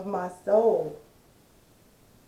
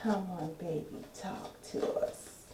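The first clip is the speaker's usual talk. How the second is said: breathy seductive whisper; sexy whisper; 10 dB quieter than usual. Pretend. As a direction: coax softly like a lover. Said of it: "in my sexy voice".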